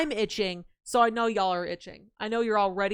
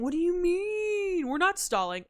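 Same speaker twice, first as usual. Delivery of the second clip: slow, higher whiny what do you mean; a slow, mock-wounded protest; mock offended; 8 semitones higher than usual. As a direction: feign hurt innocence. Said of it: "allergic to stalling".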